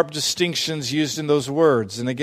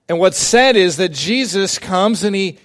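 normal talking voice

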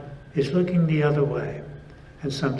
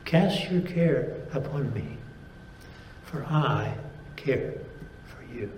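tender God speaking; 4 dB quieter than usual. Acted. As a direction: let God's promise fade gently away. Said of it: "this is what he's saying".

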